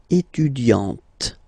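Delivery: étudiante slowed, final t clearly sounded; slow French demo. Shown as a demonstration. Teacher slowing étudiante for students, final t sounded. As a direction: stretch out étudiante and land hard on the final t. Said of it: "you insist quite much on the t".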